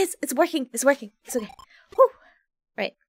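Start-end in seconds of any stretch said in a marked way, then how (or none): none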